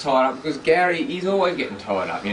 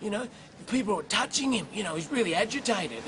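angry lady; 9 semitones higher, 5 dB quieter than usual. Retold or imitated. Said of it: "this lady's just come out of nowhere and gone".

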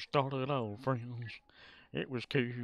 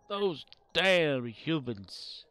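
slow, sighing mock disdain for humans; weary Spock; 4 semitones higher, much slower than usual. Spock sighing over the humans, slow and weary. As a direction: drag it out like a tired Vulcan. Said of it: "Spock's like".

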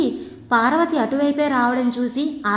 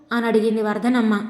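ordinary delivery, nothing marked

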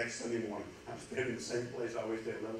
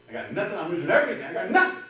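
loud, higher cry of losing it all; desperate outburst; 6 semitones higher, 11 dB louder, faster than usual. Acted. Broken man confessing to his pastor, desperate outburst. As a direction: let despair burst out at full volume.

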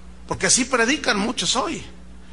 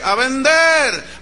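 drawn-out, raised group reply; louder answer; 5 semitones higher, 5 dB louder, much slower than usual. Retold or imitated. Staff obeying the coach, louder answer. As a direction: imitate a crowd stepping up its volume. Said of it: "Dígalo más fuerte".

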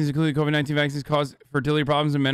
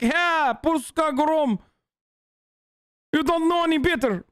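mock Polish accent; 9 semitones higher than usual. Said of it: "Terrible Polish accent".

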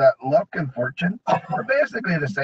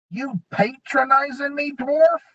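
goblin threat; 12 semitones higher than usual. A narrator's reading.

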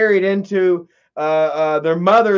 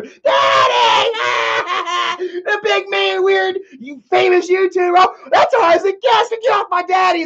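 put-on high-pitched wailing child's voice; shrill kids yelling for their daddy; crying kids; 10 semitones higher than usual. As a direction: cry out like a panicked little kid.

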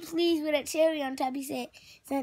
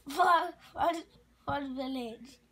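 usual speaking voice

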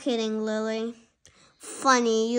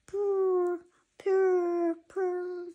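higher purring purr; 5 semitones higher, 3 dB quieter, much slower than usual. Shown as a demonstration. Lily demonstrates purring with mouth closed, higher purr. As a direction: roll the purr out softly. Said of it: "purr with your mouth closed like this".

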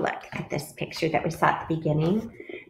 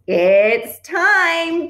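loud, high, stretched "time"; squawk; 10 semitones higher, 6 dB louder than usual. A narrator's reading. Duck announces the egg's moment, excited squawk. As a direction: squawk it out, louder and higher. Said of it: "Duck squawked".

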